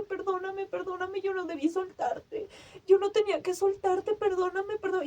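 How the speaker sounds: high, tearful pleading; desperate apology. Retold or imitated. Owner begging her dying dog's forgiveness, high desperate plea. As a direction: beg forgiveness on the edge of crying, pitch held up. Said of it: "con una desesperación horrible".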